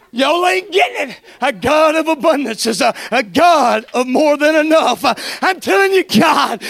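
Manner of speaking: loud, fervent preaching with shouts of praise; preaching at full force. Acted. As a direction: preach it, lifting the church.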